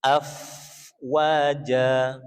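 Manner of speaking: slow "Afwaja" with long airy f; flowing afwaja. Shown as a demonstration. Teacher isolating the word for students, slow flowing f. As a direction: stretch the f in "Afwaja". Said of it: "tapi dialirkan".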